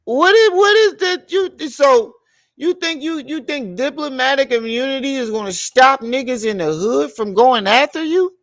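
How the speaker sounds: can't believe it, voice climbing; squeaky stunned disbelief; incredulous high rant. Real, not acted.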